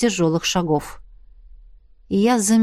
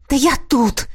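slightly hoarse, winded reply; husky, breathless answer; out-of-breath rasp; 3 semitones higher than usual. A narrator's reading. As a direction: wheeze out that you are here. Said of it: "просипел запыхавшийся эльф".

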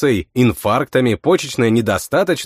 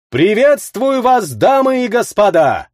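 booming town crier; 8 semitones higher than usual. A narrator's reading. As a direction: announce it to the whole arena. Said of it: "зычный голос глашатая".

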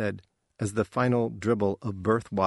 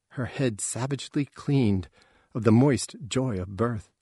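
ordinary delivery

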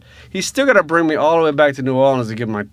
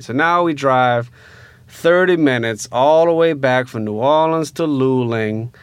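ordinary talk

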